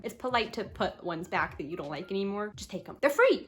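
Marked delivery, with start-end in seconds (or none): 2.6-3.0 whisper. Real, not acted. Whispered repeat.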